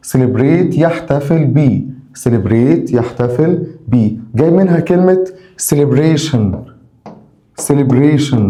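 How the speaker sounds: usual speaking voice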